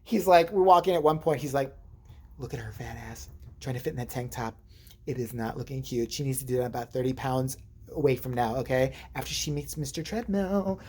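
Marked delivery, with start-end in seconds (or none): 2.4-10.8 low, hushed catty commentary; softly bitchy sassy impression; imitated catty friend. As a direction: throw shade under your breath, imitating a friend. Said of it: "He starts talking like that".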